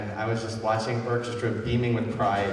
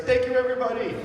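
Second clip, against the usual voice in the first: much higher, louder rushed blurt of thanks; mock frantic thanks; 6 dB louder, faster than usual. Director mimicking herself blurting thanks at night's end. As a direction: fling it out like you forgot everyone. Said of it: "and I'm just like".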